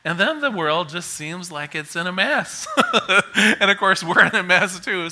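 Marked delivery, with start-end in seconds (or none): 2.7-3.5 short, louder chuckle. Real, not acted. Chuckles at her own remark. 3.6-5.1 amused, laugh riding on the words. Real, not acted. Laughing at shared mess.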